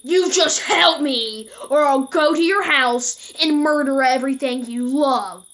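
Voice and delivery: enraged caller yelling a murder threat; furious threat. Retold or imitated. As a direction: scream the threat down the phone. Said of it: "this made him even more mad".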